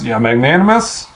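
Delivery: ordinary talk, nothing marked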